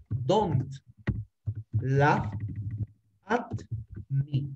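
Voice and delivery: each word set apart with long pauses. Shown as a demonstration. Teacher dictating to the class, word by word with pauses. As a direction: dictate it slowly, one word at a time. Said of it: "teacher dictating word by word".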